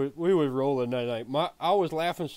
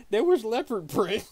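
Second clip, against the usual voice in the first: laughing quote; 7 semitones higher than usual. Retold or imitated. Quoting driver, laughing.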